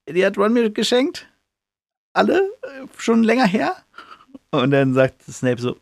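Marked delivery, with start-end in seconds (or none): 0.1-3.7 high, squeaky guilty excuses; caught schoolboy. Retold or imitated. Harry caught by Snape with his pockets full, squeaking a guilty excuse. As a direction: fumble out a lame excuse in a much higher voice.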